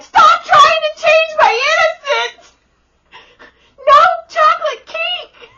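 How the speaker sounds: a hammed-up cry against the chocolate dip; mock high-pitched tantrum at the drive-through; mock outburst. Retold outburst at McDonald's.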